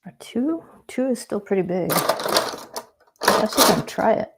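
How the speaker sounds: talking with a brush in the mouth; words muffled by a brush held in the mouth. Real, not acted. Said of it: "Sorry, I have a brush in my mouth. That's why I sound strange".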